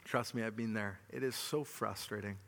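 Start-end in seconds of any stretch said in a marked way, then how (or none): none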